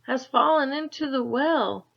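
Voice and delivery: put-on high, loud breathless boy; gasping. A narrator's reading. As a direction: gasp it out in a rush. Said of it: "he gasped".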